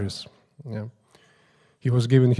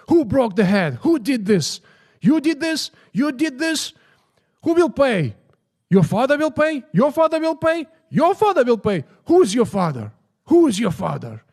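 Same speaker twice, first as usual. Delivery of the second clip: high, loud hammed-up outrage; comical shouting; 12 semitones higher, 5 dB louder than usual. Guru demanding payment for the broken peacock, comical shouting. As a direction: imitate a guru's comic tirade, pitch soaring. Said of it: "in a very comical way".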